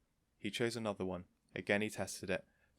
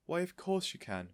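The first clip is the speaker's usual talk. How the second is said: higher, gladly welcoming; eager cry; 9 semitones higher than usual. A narrator's reading. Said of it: "Miss Silver cried".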